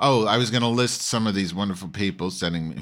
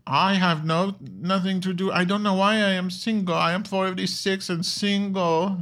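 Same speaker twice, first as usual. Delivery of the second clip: much higher, breathy-free lilting mock accent; Arianna Huffington impression; 13 semitones higher than usual. Host imitating Tracey Ullman's Arianna in bed, much higher mock voice. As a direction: play a weary socialite in bed, pitched way up. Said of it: "I can't do this impersonation".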